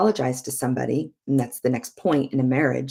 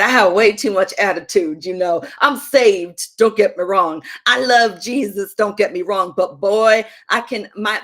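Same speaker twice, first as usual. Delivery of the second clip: loud, higher, amused through real laughs; laughing confession; 4 semitones higher, 7 dB louder than usual. Real, not acted. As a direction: crack up admitting your own faults. Laughing at her own attitude.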